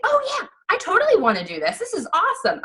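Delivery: high, fast, gushing yeah with a laugh; excited squeal. Retold or imitated. New mom telling her coach she is in, squealing and fast. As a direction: squeal like you just found the answer. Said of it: "I was like".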